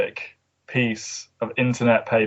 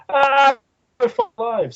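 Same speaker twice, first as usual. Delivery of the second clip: mock panicked staff; 13 semitones higher, slower than usual. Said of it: "they're like".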